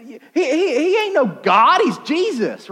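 high, loud, stretched denial; hammed-up high incredulity; scoffing townsfolk. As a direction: dismiss him like a scandalized local. Crowd acted, rejecting his divinity.

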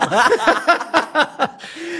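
high, hearty burst of laughter; big high laugh. Real, not acted. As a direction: laugh it out, carried away by the memory. Laughing recalling train crash.

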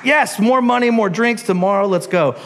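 high, gleeful party king; giddy royal yes; eager drunk king. Acted. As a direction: cheer it like a king ready to feast. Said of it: "he's like".

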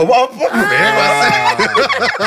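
high, slow laughing yelp; laughing shout. Real, not acted. (صيحة ضاحكة)

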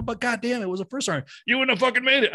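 sergeant major retort. Retold or imitated. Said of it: "I go".

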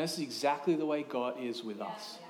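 ordinary talk

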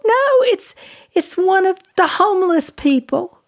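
high, distraught retold protest; imitated sobbing. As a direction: act out her tearful denial, easing down as it goes. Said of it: "And she just sobbed".

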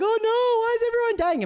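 high, fast, loud mock panic. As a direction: squeal it like a crowd panicking over the dead. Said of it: "Everyone is like".

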